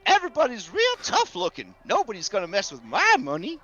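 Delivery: lightly voiced trusting rancher; proud Gus. Customer bragging about bank security, proud and excitable. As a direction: boast with a swooping, excitable lilt. Game master plays Gus.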